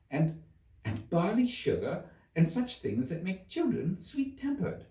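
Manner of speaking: lightly voiced girl, musing; a hint of a young girl thinking aloud; young Alice. Alice voice held by reader.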